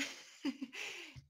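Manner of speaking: a short laugh, pitched high; amused little laugh. Real, not acted. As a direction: laugh at the memory of the class. Laughs at her own story.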